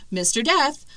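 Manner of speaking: ordinary talk, nothing marked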